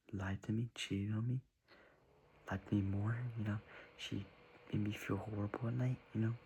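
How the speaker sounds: ordinary delivery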